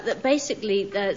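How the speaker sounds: ordinary delivery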